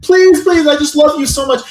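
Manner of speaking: put-on high, loud pleading girlfriend voice; psycho girlfriend pleading. Nikki pounding on the bathroom door begging Bear, high unhinged plea. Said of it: "psycho girlfriend".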